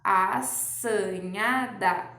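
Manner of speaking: "assanhada" very slowly, syllable by syllable; teacher stretches "assanhada" for kids; syllables drawn out. Shown as a demonstration. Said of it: "A mesma coisa no". ("assanhada" bem devagar, separando as sílabas)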